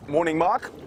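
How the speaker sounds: ordinary talk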